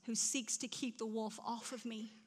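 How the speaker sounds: normal talking voice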